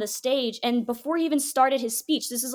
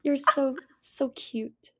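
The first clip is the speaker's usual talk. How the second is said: tender God comforting; slower than usual. Pretend. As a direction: coo like a loving parent to a baby. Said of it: "He's like".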